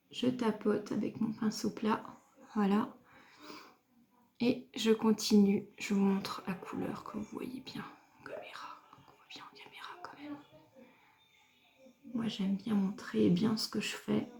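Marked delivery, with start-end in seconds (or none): 5.8-14.3 hushed close-up whisper; soft whisper. Real, not acted. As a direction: whisper as if leaning into the camera.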